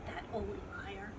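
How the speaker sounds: plain reading voice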